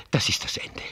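soft unvoiced whisper of doom; whispered dread. Father to son at the river, whispered dread. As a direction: breathe it out in fear.

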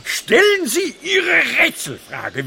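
high, slow, loud demand; impatient shout. Acted. Wilhelm urging the frog king to ask his riddle, shouting. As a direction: shout at him to get on with it.

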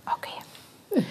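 soft whispered okay; whispering. Real, not acted. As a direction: breathe it in a whisper. Carer answers the refusal softly.

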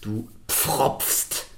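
"pfropfst" slowly, with full pf at both ends; careful complex cluster. Shown as a demonstration. Teacher announcing the winning hardest syllable, slow careful articulation. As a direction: articulate every consonant of "pfropfst". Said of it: "richtig schwer auszusprechen".

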